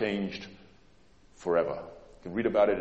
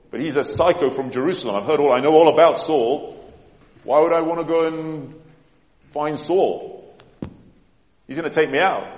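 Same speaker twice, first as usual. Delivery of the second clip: mock-panicked, higher and louder; alarmed protest; 5 semitones higher, 7 dB louder than usual. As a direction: act out a frightened man refusing a dangerous errand. Ananias protesting fearfully to God.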